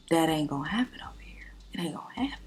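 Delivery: breathy whisper under her breath; whispered aside. Real, not acted. Aside to her viewers about the man, whispered. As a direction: whisper it like a secret to the camera.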